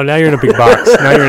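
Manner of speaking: loud laughing; laughing at a friend. Real, not acted. Mocking guest, laughing.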